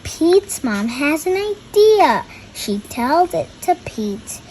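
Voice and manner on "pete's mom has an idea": ordinary delivery, nothing marked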